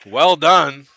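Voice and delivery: brisk live basketball call, rising on big plays. Acted. Commentator calling the game to fans, brisk play-by-play.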